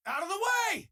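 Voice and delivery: high, commanding yell; urgent shout. Acted. Crew member yelling at enemies to clear the path, commanding shout. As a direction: shove them aside with the voice. Combat callout.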